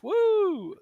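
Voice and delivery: mock cheer. Fake cheer at news.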